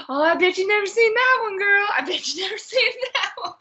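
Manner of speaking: high, loud teasing voice, fast, breaking into laughter; gleeful high taunt with a laugh. Retold or imitated. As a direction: mimic her cackling as she teases. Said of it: "And she's like".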